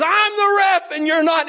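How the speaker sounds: high, loud shouted imitation of a ref; indignant referee's screamed comeback; retold scream. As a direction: yell it out at a high pitch. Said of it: "he screamed at me".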